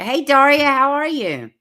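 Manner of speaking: bright, loud hello to a viewer; cheerful greeting. Real, not acted. Streamer greets a viewer in chat, cheerful and loud. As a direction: call out a happy welcome.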